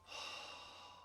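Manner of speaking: long, soft breathy sigh out; demonstrated exhale. Shown as a demonstration. Guide shows listeners how to exhale, long sighing out-breath. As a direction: let the breath go slowly and audibly. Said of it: "and as you exhale".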